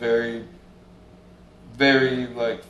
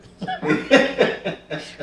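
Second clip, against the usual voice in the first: a long, airy laugh that rises high then falls away; high breathy laugh; 14 semitones higher, 5 dB louder than usual. Real, not acted. Amused at his own late realization, airy laugh. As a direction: let out a high, wheezing laugh. Laughing at Doug's hidden motive.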